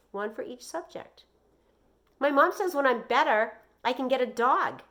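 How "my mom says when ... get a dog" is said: put-on high, louder, excited child; bursting to share good news; eager boy. Joey tells his teacher about the dog, high and excited.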